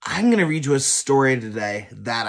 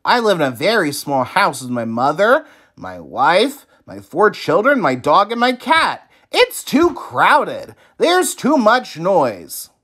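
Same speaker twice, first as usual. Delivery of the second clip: fed-up Oscar; 6 semitones higher, 4 dB louder than usual. A narrator's reading. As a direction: whine about the crowded house. Oscar complains to wise man.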